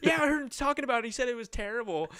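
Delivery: high, loud piling-on; mock tattling. Friend busting his balls before girlfriend, high mock tattle. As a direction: jump in loudly as the imitated tattletale. Voices another friend jumping on it.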